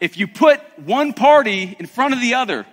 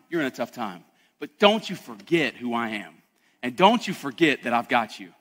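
soft, low reassurance; God's comfort, softly; 7 semitones lower, 4 dB quieter than usual. Retold or imitated. Preacher voicing God gently reassuring his discouraged people. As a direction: say it gently, like a promise.

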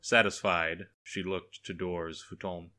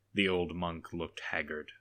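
ordinary delivery, nothing marked

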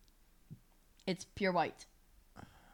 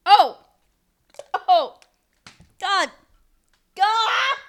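loud high shouts of disgust; disgusted shouting; 11 semitones higher, 11 dB louder, much slower than usual. Real, not acted. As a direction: yell out at a horrible taste. Tasting a disgusting jelly bean.

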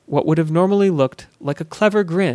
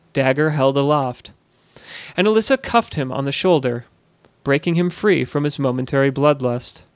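usual speaking voice

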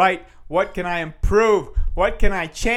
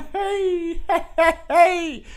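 1 laughing; 11 semitones higher, much slower than usual. Real, not acted. Laughing after joking curse.

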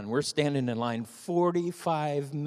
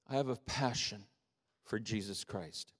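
hushed; 4 semitones lower, 10 dB quieter than usual. Real, not acted. Sudden hush on confession.

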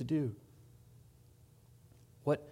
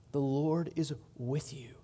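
softer, settled answer; soft reassurance; 5 dB quieter than usual. Acted. Preacher reassuring the weary congregation, softly. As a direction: drop down and comfort them.

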